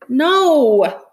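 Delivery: loud, high cry of protest; anguished refusal. A narrator's reading. Child refusing to say goodbye to the dog, crying out.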